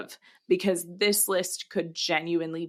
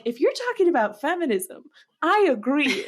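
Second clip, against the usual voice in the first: higher, quick teacher voice; a light impression of an approving teacher, pitched up; imitating the teacher; 7 semitones higher, 3 dB louder than usual. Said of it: "the teacher's like".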